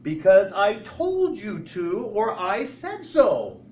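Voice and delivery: exasperated parent. Retold or imitated. Parent answering child's why, firm and loud. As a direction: lay down the law like a fed-up parent. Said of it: "what do you come back and say".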